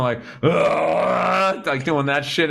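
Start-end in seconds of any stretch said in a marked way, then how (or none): 0.4-1.7 imitated retching. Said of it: "you just start going".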